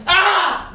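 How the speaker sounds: short high loud scream. Acted. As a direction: scream out in sudden fright.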